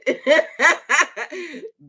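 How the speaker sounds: high, loud burst of laughter; real laughing fit. Real, not acted. Host amused that haters were big mad, cackling out loud. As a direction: crack up in loud, high peals.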